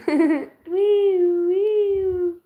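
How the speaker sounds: high, loud siren wail; siren imitation. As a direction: laugh into a wee-oo siren.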